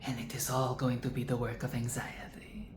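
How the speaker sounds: whispered inner thought about what's coming; hushed private worry. Retold or imitated. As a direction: breathe it as a dread-filled whisper. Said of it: "I was thinking".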